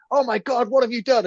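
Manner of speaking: high-pitched imitation of aghast alarm; mock horror. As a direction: gasp it out like a shocked onlooker. Retold horrified reaction on bursting in.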